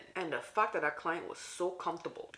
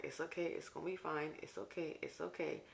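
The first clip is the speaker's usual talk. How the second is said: much softer, talking yourself down from panic; a soft self-soothing murmur mid panic attack; calming self-talk; 9 dB quieter than usual. Retold or imitated. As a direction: hush yourself into calm. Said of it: "I was like".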